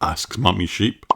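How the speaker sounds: plain reading voice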